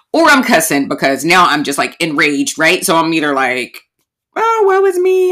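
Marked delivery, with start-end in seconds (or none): 4.4-5.3 very high, louder hammed-up lament; self-pitying wail, hammed up for laughs; mock woe. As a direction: play the melodramatic victim. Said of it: "and I'm crying".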